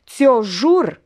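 loud first vow; vengeful vow. Acted. Olga vowing to kill her lover, loud. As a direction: spit the threat.

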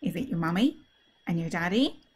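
plain reading voice

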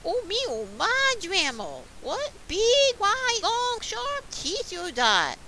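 hammed-up frightened storybook child; scared amazement. A narrator's reading. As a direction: build to loud, high alarm as the little girl. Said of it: "she's kinda scared a little".

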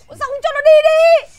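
loud, high scolding shout. Acted. Wife scolds her husband to take the boy, loud high shout. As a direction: snap at the husband for dawdling. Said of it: "bà vừa mới quát".

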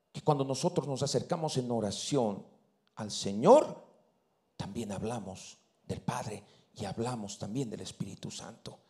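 low and soft, fading into breath; hushed preaching. Acted. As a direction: bring it down to a near whisper. Clímax íntimo del sermón. (predicación en voz baja, casi susurrada)

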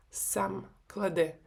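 usual speaking voice

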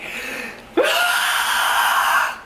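very high, long imitated scream; hammed-up high-pitched scream; little-girl scream. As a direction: shriek like a frightened little girl. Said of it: "I just yell like a little girl".

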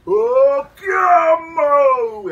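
very high ringing shout; high, loud chant leader's cry; loud call. Acted. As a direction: call the chant out to the whole forest. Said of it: "the loudest of the voices goes".

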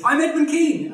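high, loud, grandiose self-announcement; Edmund Kean. Retold or imitated. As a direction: proclaim your name as a vain star. Actor announces himself to old man.

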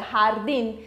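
"Jardim" com J raspado e R vibrante; rolled R. Shown as a demonstration. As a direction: vibre o R de "Jardim". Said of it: "E depois tem um R vibrante". ("Jardim" com R vibrante)

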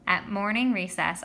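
usual speaking voice

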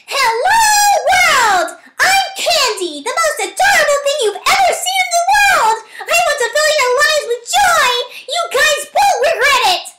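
very high, bubbly sugary voice; an adorable mascot introducing herself, very high and bubbly; annoying cutesy voice. Acted. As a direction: squeal like an overexcited cartoon mascot. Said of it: "This is an annoying voice".

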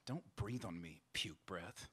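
hushed, low sibling threat. A narrator's reading. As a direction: keep it too quiet for the parents to hear. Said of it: "in a voice so low it could not be heard".

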